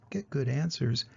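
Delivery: plain reading voice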